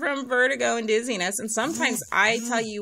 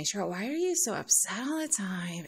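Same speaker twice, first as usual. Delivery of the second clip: soft whispered question; whispered quote; 3 semitones lower, 5 dB quieter than usual. Retold or imitated. People asking Cheryl why she's upset, whispered. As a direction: whisper it like nosy concern.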